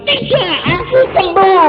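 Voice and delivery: very high, loud protest of being healed; insistent cry. Retold or imitated. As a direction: cry out that she is truly well. Mother insists she is healed. (seruan ibu yang bersikeras sudah sembuh, sangat tinggi dan keras)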